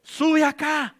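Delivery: high, loud voice of command from above; the Lord's commanding call. Acted. Preacher voicing the Lord calling his church up, booming command. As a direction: call the faithful up like God himself. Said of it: "¿qué va a hacer?".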